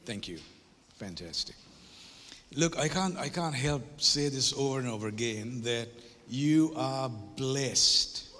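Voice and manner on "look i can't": ordinary delivery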